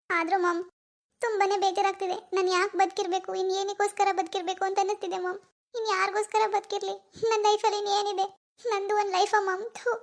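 put-on a grieving young woman close to sobbing; tearful daughter. Daughter grieving her lost baby to her mom, tearful.

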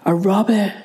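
usual speaking voice

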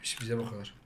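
usual speaking voice

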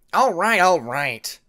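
loud, slow irritated snap; snapping back. A narrator's reading. Cliff snapping back at Jaguar, loud and irritated. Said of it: "Cliff snapped back".

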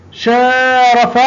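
شارف said slowly with a long aa; stretched shaa. Shown as a demonstration. Teacher drawing out شارف to show the long vowel. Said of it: "مد بالألف".

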